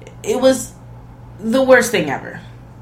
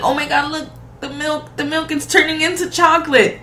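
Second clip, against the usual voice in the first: high, giddy imitation of her; high mock delight; mock excited; 8 semitones higher than usual. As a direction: squeal it like a clueless fan. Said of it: "she gets mad excited".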